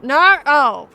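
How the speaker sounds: loud cry of dismay; shocked shout. Real, not acted. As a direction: yell it in disbelief. Reacting to a chat mistake.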